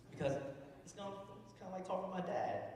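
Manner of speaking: normal talking voice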